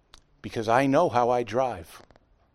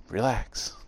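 soothing; 4 semitones lower, 6 dB quieter than usual. Retold or imitated. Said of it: "tapped my leg and went".

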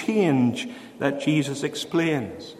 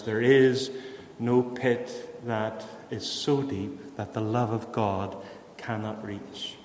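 remembered words softly; 6 semitones lower, 8 dB quieter than usual. Retold or imitated. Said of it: "she remembered Betsy's words".

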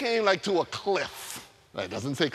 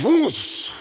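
loud, high whoosh sound effect; sudden whoosh; 7 semitones higher, 7 dB louder than usual. Pretend. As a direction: burst out a rushing whoosh. Angel appears again.

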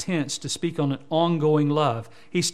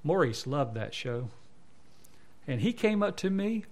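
ordinary talk

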